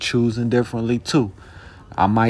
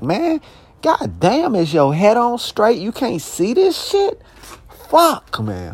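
exasperated yelling; 12 semitones higher, slower than usual. Retold or imitated. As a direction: blow up in disbelief, voice climbing high. Venting at scammers, imagined confrontation.

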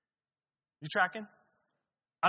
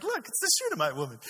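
high, quick exclamation; acted surprise; 10 semitones higher, 4 dB louder, faster than usual. Elisha pointing out the woman to his servant, acted surprise. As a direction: call attention to someone in the distance.

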